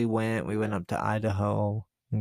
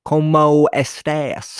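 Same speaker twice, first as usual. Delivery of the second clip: slow, loud "¿Cómo estás?" with hard American vowels; mock gringo Spanish; 6 semitones higher, 12 dB louder, much slower than usual. Shown as a demonstration. Friend demos the choppy American Spanish greeting. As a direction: stretch "¿Cómo estás?" out like a tourist. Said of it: "I do say it that way more".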